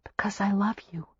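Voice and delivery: hushed loving whisper. A narrator's reading. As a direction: breathe the confession to him. Tender confession after whispered question.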